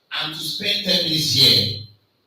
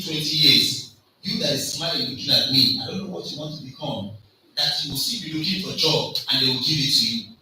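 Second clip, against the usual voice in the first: usual speaking voice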